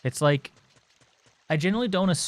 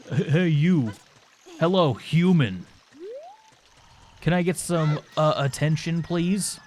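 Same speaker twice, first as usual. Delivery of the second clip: rude stranger calling; 4 semitones higher than usual. Retold or imitated. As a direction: hail a stranger like a pushy oddball, drawn out. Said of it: "than just".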